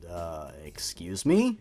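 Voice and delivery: low, slow whispered disbelief; incredulous whisper. Acted. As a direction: breathe it out in disbelief. Incredulous aside at bad writing.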